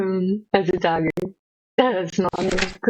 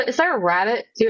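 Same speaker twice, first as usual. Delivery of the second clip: ordinary talk, nothing marked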